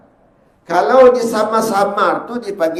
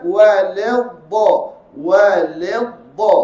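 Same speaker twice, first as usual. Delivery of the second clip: slow mock wrong reading; much slower than usual. Shown as a demonstration. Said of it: "Bukan walad".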